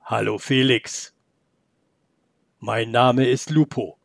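a wolf rasping a calm greeting; rough wolf voice. Acted. Wolf introduces himself to the boy, rough and slow. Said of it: "mit einer ganz rauen Stimme".

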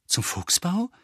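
mildly eager, rising question; hopeful. A narrator's reading. Harry asking where they are going, hopeful. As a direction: lift it at the end with hope. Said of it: "fragte Harry hoffnungsvoll".

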